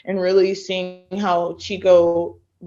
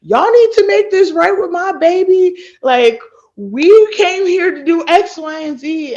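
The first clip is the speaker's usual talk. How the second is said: much higher, louder mom demanding; mother defending her daughter, higher and louder; mom's voice; 11 semitones higher, 6 dB louder than usual. Retold or imitated. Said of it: "and she was like".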